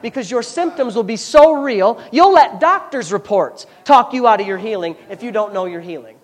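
loud, high, driving preacher's cadence; preaching at full force. Acted. Pastor warning the church about doubt, full-force preaching. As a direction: hammer it home to the congregation.

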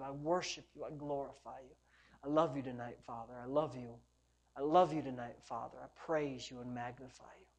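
very soft, low praying; hushed worship prayer. Real, not acted. Preacher humbles himself before the Father, hushed low prayer. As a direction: sink into quiet, repeated devotion with pauses.

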